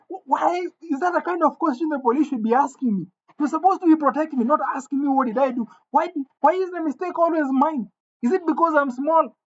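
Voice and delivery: high-pitched, indignant questioning; angry protest. Acted. Small boy complains to police who doubt him, angry. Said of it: "I'm very angry".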